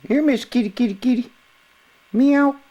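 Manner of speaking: high coaxing kitty call; calling a cat. Real, not acted.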